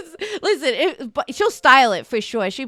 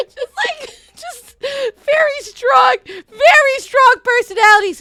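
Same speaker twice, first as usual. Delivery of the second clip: high, loud and drawn out; real high-pitched hilarity; helpless amusement; 13 semitones higher, 7 dB louder, slower than usual. Real, not acted. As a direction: squeal it out, cracking up. Cracking up while describing the aunts.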